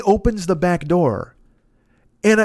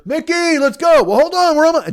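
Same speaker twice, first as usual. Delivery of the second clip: high, urgent imitated hollering; calling out; 10 semitones higher than usual. Passenger hurries his friend back to the car, imitated yell. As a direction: call across to a straggling friend.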